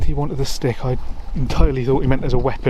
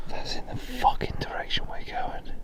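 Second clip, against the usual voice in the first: whispering; 11 dB quieter than usual. Real, not acted. Whispering plans on the path.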